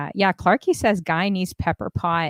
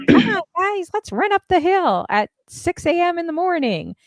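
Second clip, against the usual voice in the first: peppy coach; 11 semitones higher than usual. Retold or imitated. Friend imitating a peppy fitness leader cheering the group uphill. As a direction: pump up the group at dawn. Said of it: "she's the one that's like".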